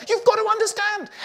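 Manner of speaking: hammed-up frantic scream, high and loud. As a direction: yell it high, acting out a panicked partner. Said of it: "we start screaming at them".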